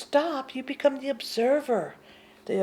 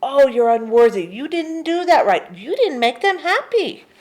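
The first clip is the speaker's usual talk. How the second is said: louder, accusing inner critic; loud mock scolding of the mind; 3 semitones higher, 8 dB louder than usual. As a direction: nag like a harsh inner voice. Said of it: "the mind is always saying".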